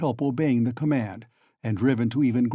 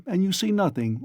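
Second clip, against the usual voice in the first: ordinary delivery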